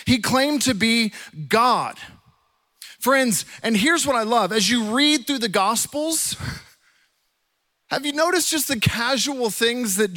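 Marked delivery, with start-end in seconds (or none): none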